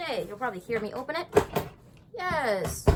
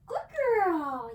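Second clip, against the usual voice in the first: higher, louder praise burst; 8 semitones higher, 9 dB louder than usual. Real, not acted. Trainer praising the dog for staying put, loud and high. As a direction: reward her with your voice.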